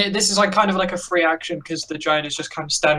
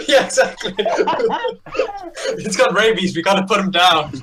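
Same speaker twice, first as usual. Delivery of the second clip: laughing; 5 semitones higher, 3 dB louder than usual. Real, not acted. GM laughs at the giant's noise.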